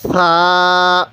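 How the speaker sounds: usual speaking voice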